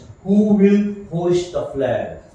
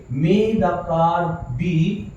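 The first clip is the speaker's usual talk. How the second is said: slow dictation; much slower than usual. Shown as a demonstration. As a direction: stretch out "may the car be" word by word. Teacher building the answer slowly. (धीमी गति से बोलते हुए)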